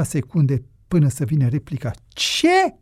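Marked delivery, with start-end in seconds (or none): 2.1-2.7 sudden high-pitched yelp of outrage; incredulous high-pitched roar; roared question. A narrator's reading. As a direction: burst out with one high, indignant cry. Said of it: "se auzi răcnetul lui Marin".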